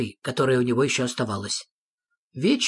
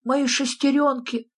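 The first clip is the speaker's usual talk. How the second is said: high-pitched nephew's exclamation; exclaiming; 10 semitones higher than usual. A narrator's reading. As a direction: exclaim in a small, high character voice. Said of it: "воскликнул его племянник".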